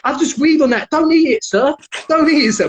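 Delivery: high-pitched, freaked-out but polite; imitating an alarmed, polite stranger; polite pleading. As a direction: beg him courteously to stop. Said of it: "Being really polite".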